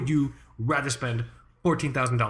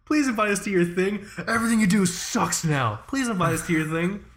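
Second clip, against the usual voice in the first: mock begging; 6 semitones higher than usual. Begging the brand for an invite.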